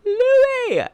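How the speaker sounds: high, loud burst of delight on Louis; excited squeal. Real, not acted. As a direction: squeal the name like a fan. Gushing over favourite character.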